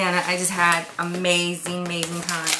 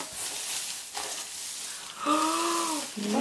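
long awed gasp; 8 semitones higher, 7 dB quieter than usual. Real, not acted. Opening a surprise item.

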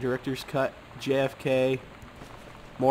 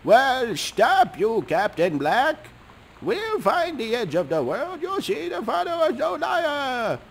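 much higher hammed-up yell; yelling first mate; 10 semitones higher, slower than usual. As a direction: rise up high in defiance. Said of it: "The first mate yelled".

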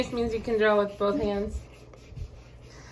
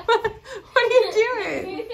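a higher, laughing mock protest; mock outraged baby; 9 semitones higher than usual. Mom voices baby's mock outrage, laughing. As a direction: pretend the baby is offended, half laughing. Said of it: "He's like".

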